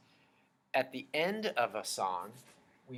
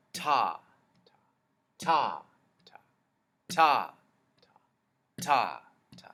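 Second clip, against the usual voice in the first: some ta punched louder than the rest; accented ta; 10 dB louder, much slower than usual. Shown as a demonstration. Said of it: "So say it with me".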